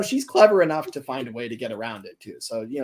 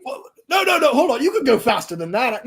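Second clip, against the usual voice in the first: frantic high protest; 9 semitones higher, 7 dB louder than usual. Acted. Driver begs Flora to hurry.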